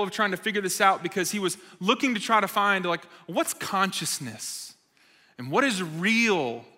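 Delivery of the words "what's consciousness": usual speaking voice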